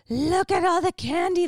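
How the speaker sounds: put-on high, loud gleeful kid voice; excited toddler. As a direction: squeal it like a kid seeing candy.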